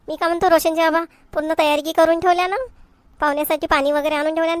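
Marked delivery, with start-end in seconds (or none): none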